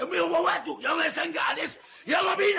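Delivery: put-on old man shouting in a street brawl; shouting demand. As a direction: yell for everyone to head to the station. Demands going to police.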